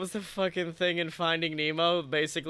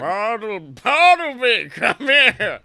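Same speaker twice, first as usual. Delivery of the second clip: loud, higher summons; calling out; 5 semitones higher, 12 dB louder, slower than usual. Retold or imitated. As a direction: call him over across the water. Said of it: "one day he's just like".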